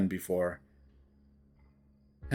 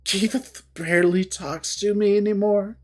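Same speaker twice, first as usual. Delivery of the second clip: high, desperate and tearful; shaky, tearful; 8 semitones higher, 3 dB louder than usual. Acted. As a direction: fight back tears. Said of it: "she breathes in shakily".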